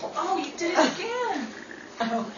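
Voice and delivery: usual speaking voice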